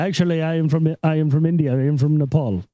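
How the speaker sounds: put-on Indian accent, earnest denial; mock Indian accent, pitch sinking; Indian store clerk. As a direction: imitate an Indian clerk correcting a customer. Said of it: "stand behind the counter".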